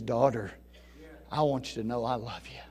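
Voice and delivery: soft, low, loving voice; tender call. Acted. Jesus speaking softly to the healed woman, tender. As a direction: say it gently like a loving father.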